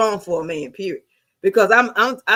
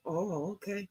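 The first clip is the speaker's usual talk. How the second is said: much softer, a small meek okay; humbled reply; 2 semitones lower, 16 dB quieter, slower than usual. Retold or imitated. Teen humbling herself to her mother, soft and meek. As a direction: swallow your pride and give in quietly.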